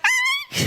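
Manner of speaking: sudden high-pitched imitated yelp; mock yelp. Retelling the dog's reaction to the vet's check, mock yelp. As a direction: yelp as if caught by surprise. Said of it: "We all yelp".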